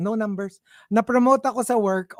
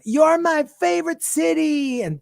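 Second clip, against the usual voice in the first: gushing idol voice; 7 semitones higher, 5 dB louder than usual. Retold or imitated. Imitating idol's concert line.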